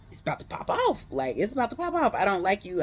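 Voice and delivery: worked-up warning. Retold or imitated. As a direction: voice leaping high on the threat. Acted threat to unwanted admirer.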